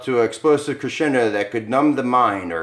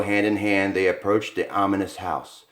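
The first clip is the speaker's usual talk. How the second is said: ordinary delivery, nothing marked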